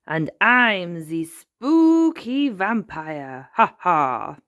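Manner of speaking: playful vampire voice; slow, drawn-out theatrical vampire with a loud ha ha; Grandpa Pig. A narrator's reading. Grandpa showing off his costume, best vampire voice. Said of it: "in his best vampire voice".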